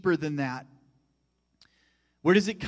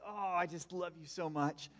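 put-on hushed, tender words to a child; tender whisper; 12 dB quieter than usual. Father hugging his youngest daughter, hushed and tender. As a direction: softly tell your little girl you love her. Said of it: "And I say".

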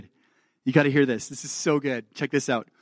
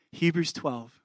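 usual speaking voice

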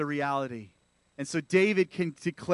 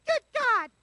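put-on much higher squeaky baby voice; newborn baby voice. Preacher joking about baby David's first words in a high baby voice. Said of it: "the first word that came out of his mouth was".

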